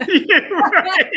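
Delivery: laughing out yeah, right; yeah, right said through a laugh; amused laughing reply. Real, not acted. Laughing at the host's story.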